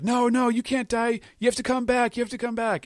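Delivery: high-pitched, mock-desperate wail; frantic pleading. Baby Five calls to a dying Lauji, mock-frantic pleading.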